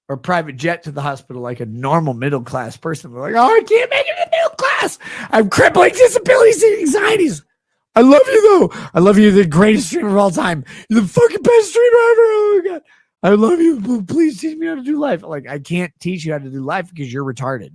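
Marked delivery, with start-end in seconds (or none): 3.3-15.2 very high, loud, fast hammed-up wail; over-the-top mock sobbing fan; shrieking mock fan. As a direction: screech it like a crying fanboy.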